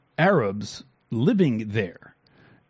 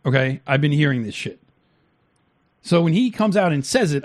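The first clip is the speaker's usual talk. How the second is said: ordinary delivery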